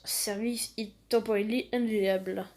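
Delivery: reading English with a heavy French accent; English read in a thick French accent. Shown as a demonstration. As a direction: sound it out like a French kid reading an error message. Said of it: "mon accent en anglais est super".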